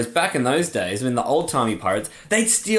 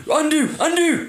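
high panicky squeak. Acted. Mock panic quote.

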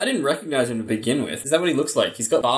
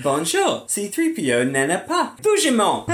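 mock French robot; 6 semitones higher than usual.

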